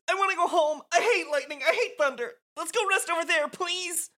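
frightened high-pitched pleading; whiny scared Rikku. Acted.

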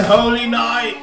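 high, loud dramatic announcement; announcer voice. Acted. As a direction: announce it grandly. Announcing with flair.

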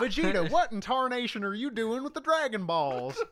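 high country bumpkin Goku voice; old prospector. Retold or imitated. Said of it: "Goku is supposed to sound like a country bumpkin".

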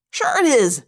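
loud, sharp contradiction; heated yell. A narrator's reading. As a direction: shout back over the denial. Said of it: "Marco yelled".

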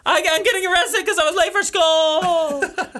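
hammed-up terrified shouting; mock panic. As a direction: yell like you're being hauled off. Mock panic about being arrested.

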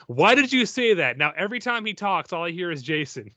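put-out mock mom voice; exasperated mom. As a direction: imitate a mother's indignant complaint. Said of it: "my mom being like".

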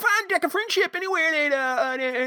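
put-on high, hollering old prospector voice. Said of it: "You sound like R".

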